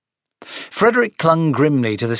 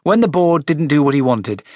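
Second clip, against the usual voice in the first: ordinary delivery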